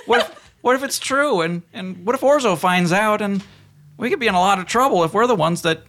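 very high, anxious underling voice; high-pitched, stammering comic underling. Acted. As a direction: plead the case in a high minion voice. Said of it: "a low-ranking vampire officer".

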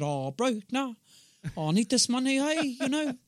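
high Maori English plea; Maori accent. Acted. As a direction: plead in a put-on Maori accent. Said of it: "got my Maori accent down".

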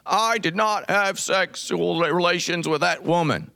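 a mild take on Clinton's famous denial; Bill Clinton impression. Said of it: "That's my best Bill Clinton that I can do".

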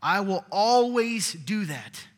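loud, rising preacher's cry; fervent sermon climax; preaching at full force. Acted. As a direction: proclaim it to the whole room. Preaching God's promises.

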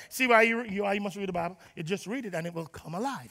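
ordinary delivery

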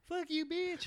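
high-pitched, loud mock kid voice; little boy. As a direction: squeal it like a cheeky eight-year-old. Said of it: "eight, nine years old".

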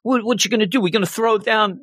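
a lightly voiced cocky bully; bully taunt. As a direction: egg him on like a cocky bully. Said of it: "he's like".

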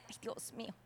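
barely voiced sigh of dismay; whispered aside. Churchgoer checking the time during ministry, whispered dismay. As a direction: whisper it under your breath.

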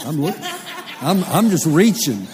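loud, high, laughing excitement; excited. Real, not acted. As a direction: shout it out with joy. Excited retelling, reaching for handshakes.

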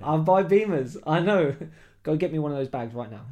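no-nonsense street swagger; street attitude. Retold or imitated.

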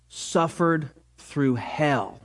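usual speaking voice

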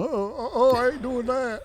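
high, whimpering fright; hammed-up frightened whine; mock scared biker. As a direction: ham up a tough guy chickening out. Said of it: "six Hell's Angels over there going".

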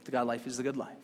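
quiet weighty restatement; soft emphatic reversal. Shown as a demonstration. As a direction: drop the voice to seal the point. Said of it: "The good life is the God life".

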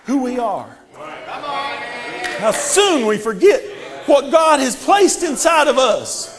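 shouting sermon climax; full-force preaching. Acted. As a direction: build to a roaring climax.

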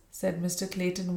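plain reading voice

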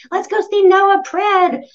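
high, loud, gushing friend's invite; excited friend. Retold or imitated. Girlfriend calling to invite her out, high and loud excited gush. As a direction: squeal it like an eager old raver pal. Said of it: "she called me and was like".

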